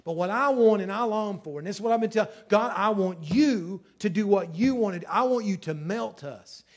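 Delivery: loud, high, urgent sermon climax; a preacher's fervent climax; preaching at full force. Real, not acted. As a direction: build and plead with the church.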